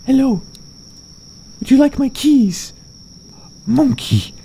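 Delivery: cutesy voice. Pretend. Talking to the monkey.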